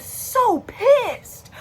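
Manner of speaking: high, drawn-out venting; slow, high-pitched retold fury; exaggerated anger. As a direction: stretch it out with hammed-up outrage. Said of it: "I get angry too".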